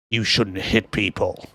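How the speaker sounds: slow, low, quiet Job line; lightly voiced calm Job; Job's line. Recapping the film, Job scolds the man who hits him: slow, low, quiet.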